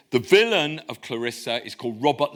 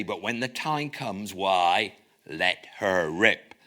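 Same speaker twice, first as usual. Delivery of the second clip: put-on cod Devon accent. Silver voting for murder to his crew, cod Devon accent. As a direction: play Silver the West Country villain, half in jest. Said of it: "read this in a sort of cod Devon accent".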